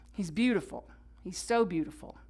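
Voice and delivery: ordinary talk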